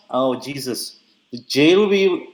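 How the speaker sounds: ordinary delivery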